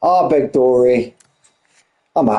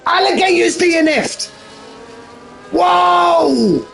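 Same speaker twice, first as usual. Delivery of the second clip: excited shout. Real, not acted. Commentator yelling as Alligator drops out of the race, excited shout. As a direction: shriek as a car crashes out. Rival crashes out late.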